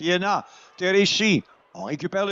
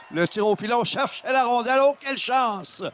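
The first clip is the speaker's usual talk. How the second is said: excited; 8 semitones higher than usual. Real, not acted. Scramble in front of net.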